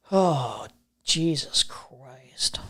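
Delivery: breathless whispered Jesus Christ, very slow. Real, not acted. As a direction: let it slip out under your breath. Muttering to himself at the stream.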